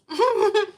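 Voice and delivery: high, loud burst of laughter. Real, not acted. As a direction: crack up at herself. Laughing at the story.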